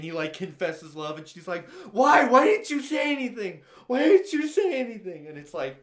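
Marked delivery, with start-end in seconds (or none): 1.9-5.3 high, loud desperate wail; acted-out distraught cry; imitated anguish. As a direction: demand it in acted heartbreak. Retells girl's anguished reply to confession.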